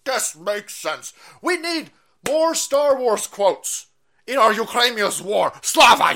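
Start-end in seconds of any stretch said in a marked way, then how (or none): 0.0-1.1 slow, dopey mocking voice; mock dumb voice. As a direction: drawl it out like a clueless fool. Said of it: "or even read about war goes".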